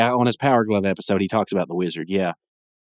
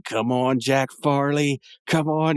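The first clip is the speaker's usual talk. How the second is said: Jack Farley cheering himself on; 4 semitones higher, slower than usual. Retold or imitated. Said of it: "It's Mark Hamill voicing him".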